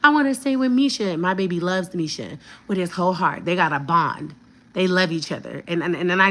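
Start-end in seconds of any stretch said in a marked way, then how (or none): 0.0-1.1 put-on higher pleading toddler voice; child voice. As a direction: whine like a little boy. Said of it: "he's like".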